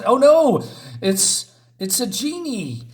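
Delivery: hammed-up oh no; mock alarm. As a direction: sell the surprise of the genie. Narrator alarm at genie.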